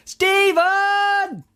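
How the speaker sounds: long high hollered name; calling out. Retold or imitated. Retelling calling across a crowd, a long high holler.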